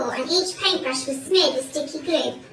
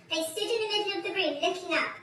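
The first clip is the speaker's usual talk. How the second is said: usual speaking voice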